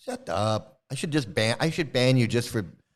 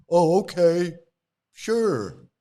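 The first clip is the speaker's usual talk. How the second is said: mock agreement; 3 semitones higher, 5 dB louder, much slower than usual. Ex-follower answers the guru's boast, sarcastic. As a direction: play the gullible follower, sarcastically.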